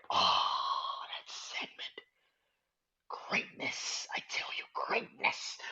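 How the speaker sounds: hushed whisper in reverence; awed whisper. Real, not acted. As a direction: breathe it out in awe. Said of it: "whispers in awe".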